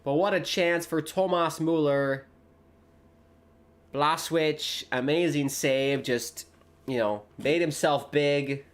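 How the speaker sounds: lively live match call; play-by-play commentary. Acted. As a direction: call the action as it happens.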